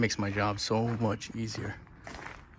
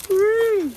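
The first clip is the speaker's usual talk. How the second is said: high, loud "woo" of triumph; real whoop of satisfaction; celebratory whoop; 10 dB louder than usual. Real, not acted. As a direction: let out a long, high-pitched cheer. Cheering the finished tape pull.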